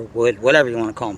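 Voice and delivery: normal talking voice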